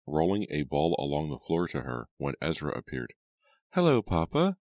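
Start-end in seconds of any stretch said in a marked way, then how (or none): 3.7-4.6 put-on high, loud little-girl hello; bright greeting. A narrator's reading. Abigail greets her father, brightly and eagerly. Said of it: "said Abigail brightly".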